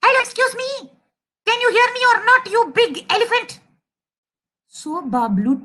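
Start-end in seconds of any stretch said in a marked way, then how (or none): 0.0-3.6 high, squeaky indignant little voice; indignant mouse. Acted. Tiny mouse hailing a big elephant, high and indignant. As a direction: call up crossly in a high voice.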